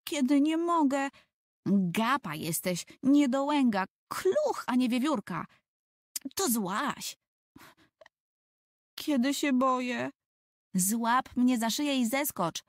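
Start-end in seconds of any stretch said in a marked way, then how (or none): 0.0-1.1 put-on higher, plaintive small boy; higher childish complaint, stretched mogę; whining little boy. Tomek stuck whining on branch. 1.7-7.2 higher, teasing scold; mock scolding in a higher girl's voice. Ewa scolding Tomek. 9.0-10.1 put-on higher, timid child's voice; a touch of childish fear, higher; scared little boy. Tomek scared on tree.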